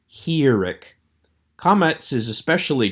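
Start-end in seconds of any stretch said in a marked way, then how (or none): 0.1-0.9 "Hirik." with a long ee, as in machine; long E pronunciation. Shown as a demonstration. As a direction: say "Hirik." as "hee-rik". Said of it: "pronouncing it as a long E".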